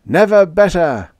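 high, loud joyful shout; elated shout. A narrator's reading. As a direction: call it out at the top of the range. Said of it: "they shouted".

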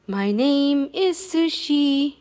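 each word spaced out slowly; slow model line. Shown as a demonstration. Teaching the dog its name, slowly.

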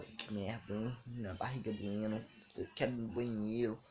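low, hushed self-talk; very quiet, low imitation of inner distress; private groaning complaint. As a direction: grumble softly with a bellyache. Barriga doendo, fala para si.

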